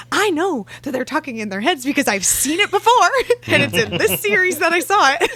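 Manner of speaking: high, loud, breaking into laughter; excited insistence. Retold or imitated. Reader insisting she spotted the telepathy, high and excited. Acts out her own excited reaction.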